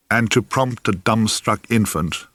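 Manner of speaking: ordinary delivery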